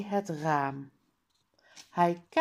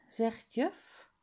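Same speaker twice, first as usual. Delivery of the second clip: ordinary delivery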